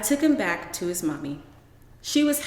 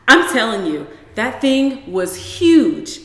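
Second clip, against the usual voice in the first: insistent exaggeration; 4 semitones higher, 9 dB louder, slower than usual. Mother insists frog was huge.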